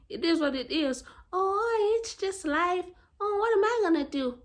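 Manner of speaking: high, whiny mock resignation; mock helpless people. Mimicking people who say it's just life, high mocking whine. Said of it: "Of people who say".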